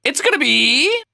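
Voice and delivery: a high teasing build before the reveal; peak suspense. Acted. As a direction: push the tension to the top.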